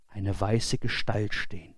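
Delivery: ordinary delivery, nothing marked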